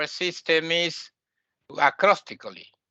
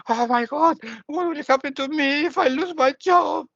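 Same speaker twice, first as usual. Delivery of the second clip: higher, fretful voice of someone scared for their job; mock worried wail; 9 semitones higher than usual. Teacher mimicking a student's fear of losing a job, higher fretful wail. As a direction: play the panicked worrier, pitched up.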